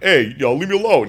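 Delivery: lightly voiced annoyed celebrity; irritated Stallone. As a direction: brush off the fan, annoyed. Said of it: "he's like".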